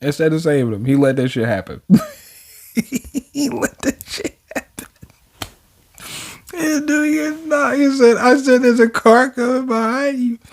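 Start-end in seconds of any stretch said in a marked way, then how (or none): 2.7-4.9 high, soft, slow words squeezed through laughter; real laughing. Real, not acted. Reader cracks up over the hero allowing a crash, laughing. 6.5-10.4 high-pitched voice on the edge of laughing; amused, near laughter. Real, not acted. Reader retells the ignored car warning, voice high with amusement. Still cracking up at crash.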